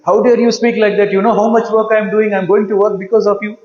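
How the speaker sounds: angry reaction. Shown as a demonstration. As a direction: snap back at the husband fast and loud. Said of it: "Reacting means you start reacting".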